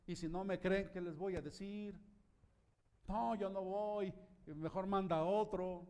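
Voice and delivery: doubting Moses. Acted. Moses pleading doubts to God.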